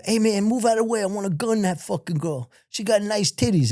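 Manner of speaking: high mock yell, no real volume; retold yelling. Inmates heckling during a family visit, mock yelling. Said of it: "they're saying and yelling it".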